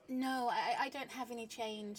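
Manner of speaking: ordinary talk, nothing marked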